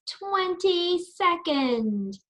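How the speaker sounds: "twenty-second" said very slowly and high; slow model word. Shown as a demonstration. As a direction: draw out "twenty-second" for children to repeat. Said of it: "Can you say that?".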